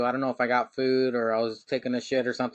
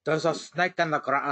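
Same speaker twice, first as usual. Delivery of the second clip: put-on barely-there Irish accent, cut off; Finn Balor voice. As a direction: start a Finn Balor line in an Irish accent, then give up. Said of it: "try to say snake in the grass as Finn Balor".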